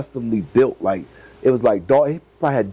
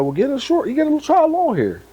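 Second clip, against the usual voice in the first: higher voice for the breeder's advice; lightly voiced older breeder, pitched up; imitating the breeder; 14 semitones higher than usual.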